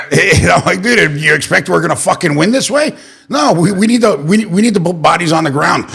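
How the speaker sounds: high, heated disbelief at his own party; exasperated rant. Retold or imitated. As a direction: voice jumps up, fed up and incredulous. Ranting about party's weak outreach.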